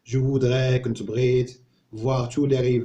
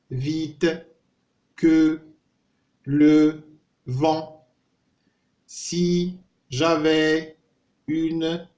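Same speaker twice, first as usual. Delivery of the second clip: slow dictation, each word isolated and held on a steady raised note; 4 semitones higher, 5 dB louder, much slower than usual. Shown as a demonstration. Teacher helps weak readers memorise the poem, word by word. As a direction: say one word at a time, drawn out, with a pause after each. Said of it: "نعاودوا هذا بكلمة بكلمة".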